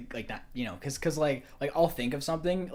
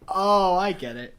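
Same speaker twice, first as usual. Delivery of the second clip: laughing realization; 6 semitones higher, 8 dB louder, slower than usual. Retold or imitated. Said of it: "But now I'm like".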